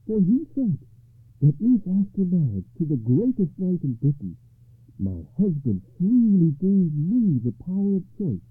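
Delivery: high, loud, triumphant; exultant noblewoman's ringing declaration; joyful lady. A narrator's reading. Lady rejoices at broken spell.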